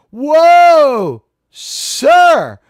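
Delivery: high, loud, drawn-out whoa of surprise; surprised whoa. Real, not acted. Streamer startled by a donation, loud surprised whoa. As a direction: shout out in delight. Big Super Chat arrives.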